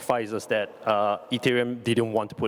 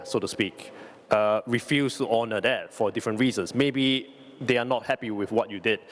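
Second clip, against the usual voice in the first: ordinary talk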